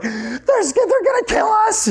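very high-pitched mock panic; panicked yell. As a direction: blurt out the fear, voice leaping up. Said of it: "You just yell things out".

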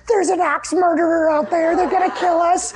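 high-pitched hysterical impression of a mother; freaking-out mom. Mom warning the family in the camper, freaking out. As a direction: wail the warning in hammed-up panic. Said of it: "freaking out".

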